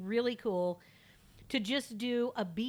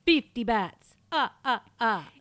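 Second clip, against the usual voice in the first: vampire count; 3 semitones higher, 10 dB louder than usual. Acted. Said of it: "I'm gonna do a vampire voice".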